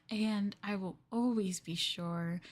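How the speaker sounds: soft, slow, sweetly possessive lover; a clingy lover toasting a life together, softly; yandere lover. Acted. ASMR yandere girlfriend toasting her darling, sweetly.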